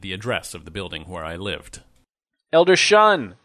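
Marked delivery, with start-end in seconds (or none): 2.5-3.4 high, loud excited call; a glad shout across the room; excited cry. Acted. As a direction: call out his name in delight. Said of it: "cried Elder Fearing".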